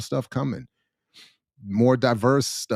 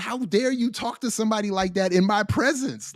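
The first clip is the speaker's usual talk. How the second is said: high-pitched indignant challenge; offended; 8 semitones higher than usual. Retold or imitated. Retelling a challenge to a screaming boss, offended. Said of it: "I was offended".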